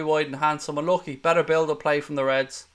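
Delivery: ordinary talk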